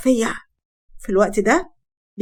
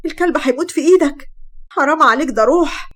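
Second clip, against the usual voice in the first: high, loud frantic begging; desperate screaming plea; 5 semitones higher, 3 dB louder than usual. Acted. Wife screaming at her husband to stop beating the dog. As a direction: cry out to stop him hurting the dog.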